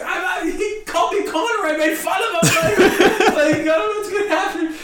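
high-pitched mock panic with a laugh; freaking out. Comic who mocked the armed booker, freaking out. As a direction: babble it out as a comic fearing payback. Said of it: "I'm freaking out".

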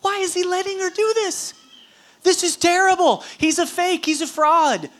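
high-pitched, scandalized inner complaint; hammed-up outrage at a dinner guest; indignant Pharisee's thoughts. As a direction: fret in a high put-on voice, appalled. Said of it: "the Pharisee is thinking to himself".

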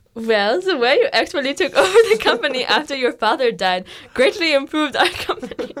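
hammed-up muddled British-German accent; bad mock accent. Shown as a demonstration. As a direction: perform a bad European accent, nearly laughing. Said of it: "do you wanna hear my accent".

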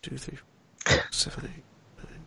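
muttered counting under the breath; counting cpr compressions. Real, not acted. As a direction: count it off in a near whisper. Counting dice under his breath.

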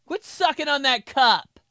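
high loud nagging mom; shrill angry mom voice; mom scolding. Retold or imitated. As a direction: scold like a fed-up mother. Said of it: "my mom".

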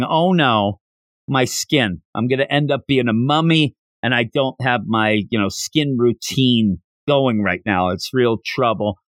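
normal talking voice

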